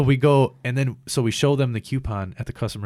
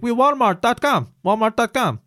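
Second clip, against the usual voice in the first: put-on high, loud caricatured Indian accent; mock Indian accent; 13 semitones higher, 7 dB louder than usual. Customer retelling the phone agent's words, mock Indian accent.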